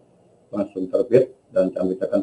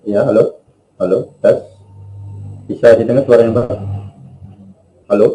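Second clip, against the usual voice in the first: much louder, clear into the mic; louder mic check; 3 semitones higher, 6 dB louder than usual. Real, not acted. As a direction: project so the room can hear. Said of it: "suaranya terlalu kecil".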